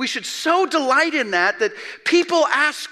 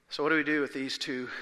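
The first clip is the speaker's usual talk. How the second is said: usual speaking voice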